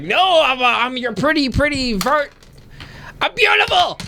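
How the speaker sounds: high pleading hag. Acted. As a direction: simper like a vain hag. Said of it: "she's just like".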